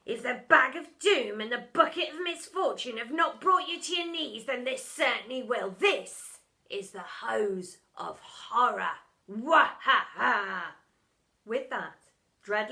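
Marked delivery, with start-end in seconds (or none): 0.1-10.8 gloating villain. A narrator's reading. Teacher gloating over his trap, hammed-up. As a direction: ham up a mad teacher's triumph.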